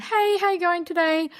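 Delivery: high chirpy greeting; bright cheerful real estate agent hello. Retold or imitated. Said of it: "really chirpy".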